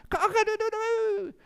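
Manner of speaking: loud, high crowing cock-a-doodle-doo; rooster crow. Acted. Young rooster finally crows on the fence, loud high crow. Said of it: "it started to make a noise".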